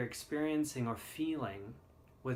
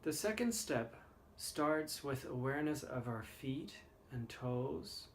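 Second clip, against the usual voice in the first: guided meditation; 6 dB quieter than usual. Acted. Leads the body scan meditation.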